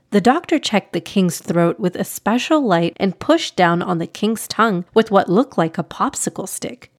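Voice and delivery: ordinary delivery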